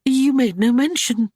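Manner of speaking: high, embarrassed backpedal; flustered. A narrator's reading. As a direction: cover your slip, flustered. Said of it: "she stammered in sudden confusion".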